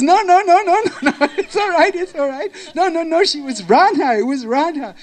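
high, loud volley of objections; hammed-up panicky denial; flustered protest. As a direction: blurt it out, desperate to deflect blame. Kundalata panics at being hugged.

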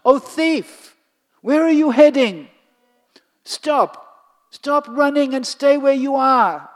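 loud high calling out. Acted. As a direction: call after him, loud and high. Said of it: "as she calls out to him".

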